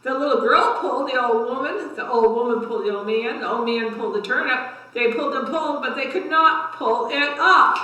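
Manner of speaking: lively, rising read-aloud for little ones; story time voice for a class; reading to children. A narrator's reading. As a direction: build the effort with each pull. Reads cumulative tale to kids.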